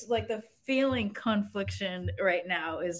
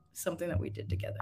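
whisper; 3 semitones lower, 9 dB quieter, much faster than usual. Real, not acted. Emotional farewell, voice drops.

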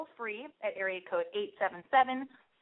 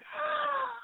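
sharp, high, shocked gasp; dramatic gasp; 4 dB quieter than usual. Acted. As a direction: gasp as if scandalised. Dramatic gasp at guy not texting.

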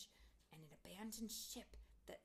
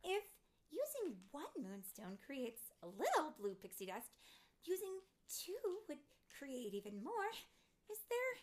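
high-pitched nervous asking, rising at the end; 11 semitones higher, 6 dB louder than usual. A narrator's reading. Tink asks Fairy Mary for another moonstone, nervous. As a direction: pitch it up, anxious and hopeful. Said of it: "said Tink nervously".

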